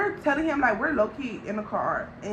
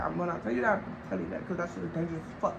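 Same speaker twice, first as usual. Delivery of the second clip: much softer, low, confiding; hushed confiding; 5 semitones lower, 8 dB quieter, faster than usual. Real, not acted. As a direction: lean in and say it under your breath. Confiding aside.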